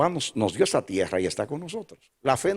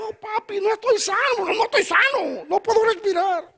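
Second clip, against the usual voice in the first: put-on high, crying child's voice, far higher. Son crying to his father at dawn, high tearful child voice. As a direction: imitate a sick son crying that he can't breathe. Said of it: "Y él dice, llorando".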